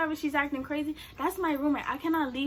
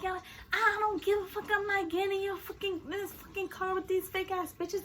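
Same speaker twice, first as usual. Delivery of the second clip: high, soft mimicry of a rant; hushed mock tantrum; mock defiant roommate; 5 semitones higher, 7 dB quieter than usual. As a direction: mimic a friend's stubborn refusal. Imitating roommate's angry refusal.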